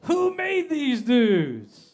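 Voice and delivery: comic outburst at a date, loud and drawn out; boiled-over yell. Retold or imitated. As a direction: explode at the date in hammed-up outrage. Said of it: "I boiled over".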